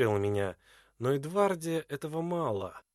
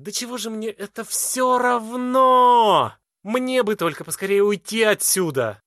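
loud, higher angry tirade, voice cracking; angry outburst; 5 semitones higher, 8 dB louder than usual. A narrator's reading. Edvarda raging at the hermit who insulted her prince. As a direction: hurl it at him, voice breaking with rage. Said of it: "голос её срывается от гнева".

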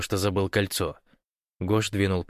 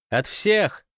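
high-pitched, raised call; 10 semitones higher, 4 dB louder than usual. A narrator's reading. Irina sums up everyone's greetings, calling out.

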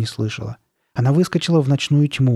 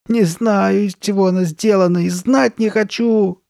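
high, whining moan; 8 semitones higher, 4 dB louder than usual. A narrator's reading. Carly Beth to Sabrina about the mask, moaning. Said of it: "застонала Карли Бет".

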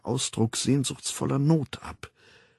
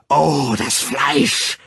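slow, loud, raised outcry; frenzied cry; 4 semitones higher, 6 dB louder, much slower than usual. A narrator's reading. The Savage cursing the flesh, frenzied cry. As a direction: cry out, drawing the words long.

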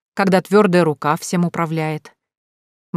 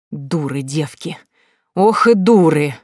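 злобное шипение; 4 dB louder, slower than usual. A narrator's reading. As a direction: spit out the insult slowly, with venom. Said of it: "злобно прошипела Потоцкая". (злобно, сквозь зубы)

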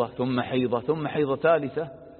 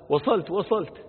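lightly acted, higher-pitched reassurance; higher voice, as if placating a waiting wife; husband's phone reply; 7 semitones higher than usual.

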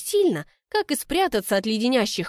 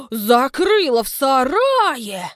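gasping accusation; 4 semitones higher, 6 dB louder, slower than usual. Acted. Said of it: "ахает она".

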